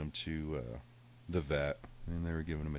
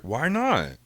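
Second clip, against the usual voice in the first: higher, louder innocent cat whine; 8 semitones higher, 9 dB louder than usual. Retold or imitated. Cat protesting the locked door, high and loud. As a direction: sound like the cat playing dumb.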